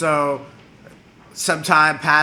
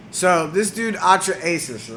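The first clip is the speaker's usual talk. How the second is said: normal talking voice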